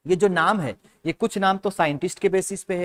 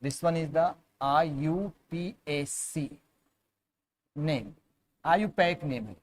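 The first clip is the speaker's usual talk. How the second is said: model answer; 7 dB quieter, slower than usual. Shown as a demonstration. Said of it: "So आप इसको बोलोगे".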